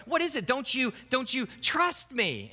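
put-on high-pitched, indignant teen protest; offended child. Child goes back to parents after a refusal, offended. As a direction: act out a wounded kid challenging the parents. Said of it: "the child will be offended".

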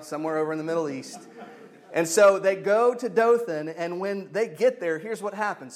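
ordinary talk, nothing marked

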